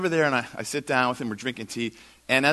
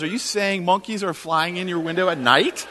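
raised, louder disbelieving question; incredulous; 7 semitones higher, 6 dB louder than usual. Retold or imitated. Landlord checking tenant's flying monkey story, incredulous. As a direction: spell out the absurd claim in disbelief.

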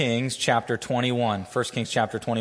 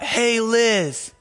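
higher-pitched playground call, half mock yell; 8 semitones higher than usual. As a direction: call across the schoolyard to get her attention. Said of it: "yelled out".

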